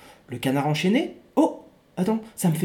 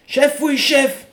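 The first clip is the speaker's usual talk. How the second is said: slow, loud, higher yes sir; soldier's reply; 7 semitones higher, 6 dB louder, much slower than usual. Acted. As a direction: shout it like a recruit. Soldier answering chief.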